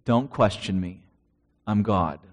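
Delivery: low, quiet voice of God; a hushed, low divine voice; God speaking. Retold or imitated. God's voice in retelling.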